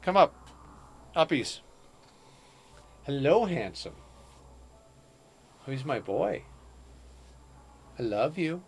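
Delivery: high, soft cooing to a pet. Real, not acted. Owner coaxing her cat up, soft and high. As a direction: sweet-talk the cat gently.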